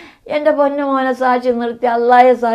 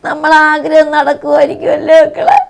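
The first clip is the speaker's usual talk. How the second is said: crying; 3 semitones higher, 10 dB louder than usual. Real, not acted. Mother pleads to dead son.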